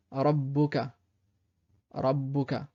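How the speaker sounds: rabbuka avec le bb appuyé; doubled b. Shown as a demonstration. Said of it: "la shadda est sur le bas". (rabbuka, bien doubler le b)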